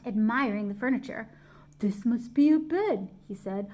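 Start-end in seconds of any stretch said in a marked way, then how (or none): 1.8-3.1 lightly voiced small bear, a bit louder; a hint of a curious toy bear; teddy bear voice. A narrator's reading.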